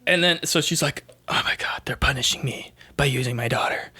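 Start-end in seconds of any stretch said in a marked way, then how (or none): 1.3-3.9 voiceless, whispery dawning horror; mock hushed realization. Nun seeing her daughter's rosary, hushed mock horror. Retold nun's horrified realization.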